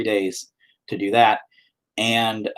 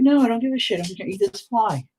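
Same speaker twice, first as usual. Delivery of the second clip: lightly voiced dog, higher and offhand; voicing the dog; 10 semitones higher, faster than usual. Voicing the dog's reply to the camera offer. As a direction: give the dog a bored voice. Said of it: "You're like".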